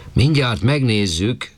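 high, slow call from the roof. A narrator's reading. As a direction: draw it out, calling down from up high. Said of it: "kiabált le".

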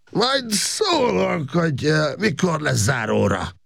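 put-on high, drawn-out drunken faltering; tipsy hesitation, pitch way up; halting, tipsy voice. A narrator's reading. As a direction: stumble slowly through it as if drunk. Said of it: "mondta Makos Gábor akadozó hangon".

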